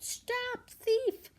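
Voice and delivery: high loud cry of stop thief; shout. Acted. Mr McGregor shouting at Peter, high and loud. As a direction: yell after the fleeing rabbit. Farmer shouting after rabbit.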